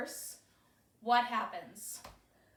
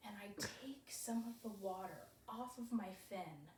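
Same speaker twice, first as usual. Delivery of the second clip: whispering; 2 semitones lower, 13 dB quieter than usual. Retold or imitated.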